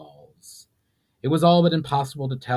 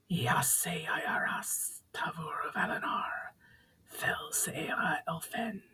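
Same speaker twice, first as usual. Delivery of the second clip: slow, soft, high unvoiced whisper; a spirit breathing the ancient greeting; ghostly whisper; 7 semitones higher, 9 dB quieter, slower than usual. Spirit greeting Valinar in old tongue, ghostly whisper.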